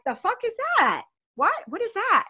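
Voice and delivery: high-pitched incredulous questions; startled "what on earth" questioning; retold alarmed disbelief. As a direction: reenact shock, pitch leaping up. Retold shock at seeing the rig.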